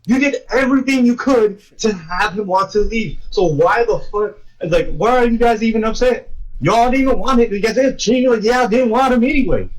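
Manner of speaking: high-pitched mock tirade; worked-up, half-laughing indignation; indignant rant. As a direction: scold them in exasperated disbelief. Acted rant at retold characters.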